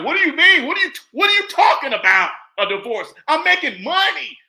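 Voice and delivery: loud, high, disbelieving shout; shocked protest. Retold or imitated. Husband hearing divorce news, shouting in disbelief. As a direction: protest in outrage that she wants out. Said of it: "He's like".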